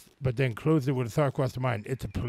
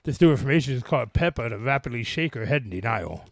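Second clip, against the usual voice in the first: normal talking voice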